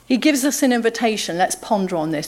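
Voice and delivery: ordinary talk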